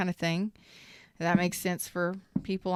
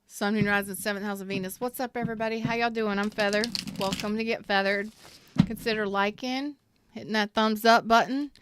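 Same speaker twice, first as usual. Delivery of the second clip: ordinary delivery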